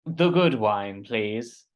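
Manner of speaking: drawn-out, lightly posh gnome; slow, stretched posh gnome voice. Acted. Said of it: "posh gnome voice".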